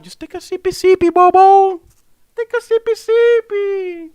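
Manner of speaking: very high squeaky singsong; silly squeaky voice. Pretend. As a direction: squeal it playfully, far above your voice. Silly sing-song pause to sip.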